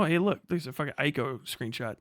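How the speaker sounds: ordinary talk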